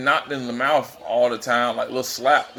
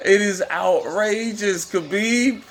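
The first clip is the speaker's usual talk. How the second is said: amazed; 6 semitones higher, slower than usual. Real, not acted. Marvelling at Khabib's leg control.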